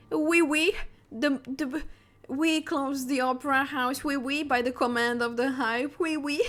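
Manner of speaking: exaggerated fake French accent with oui oui; hammed-up French guard impression; mock French accent. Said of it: "French accent".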